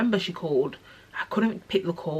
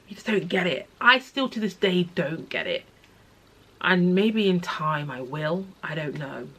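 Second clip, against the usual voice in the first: ordinary delivery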